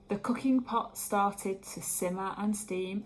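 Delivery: plain reading voice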